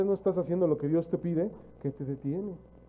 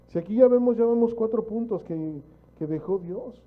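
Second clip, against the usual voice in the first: sudden loud, higher push; loud preaching; 6 semitones higher, 6 dB louder than usual. Real, not acted. Preacher pressing the congregation, loud and urgent. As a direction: raise the voice to drive the point home.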